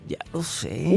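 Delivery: sultry voice. Retold or imitated. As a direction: lightly imitate her sultry voice. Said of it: "¿Cómo dijiste?".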